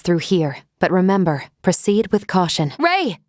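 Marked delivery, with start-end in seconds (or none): 2.8-3.2 sharp high call across the cave. Acted. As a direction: call his name to warn him. Calls out to Ray in danger.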